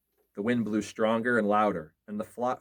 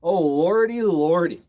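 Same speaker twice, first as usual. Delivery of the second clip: higher, loud frightened moan; terrified cry; 7 semitones higher, 9 dB louder, slower than usual. A narrator's reading. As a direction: quake with fear as you say it. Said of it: "shivered and shook and cried".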